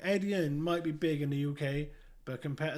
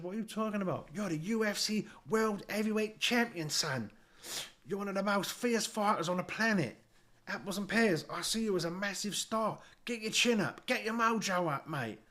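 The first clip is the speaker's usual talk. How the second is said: fake Essex geezer; 7 semitones higher than usual. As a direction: ham up a cheeky Essex geezer accent. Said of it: "With me fake Essex geezer act".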